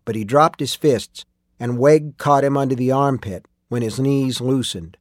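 ordinary delivery, nothing marked

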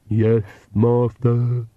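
very slow, lisping henchman; ludicrous lisped servant drawl; ridiculous servant voice. Acted. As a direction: drag it out like a creepy vampire's servant. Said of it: "And the ridiculous voice".

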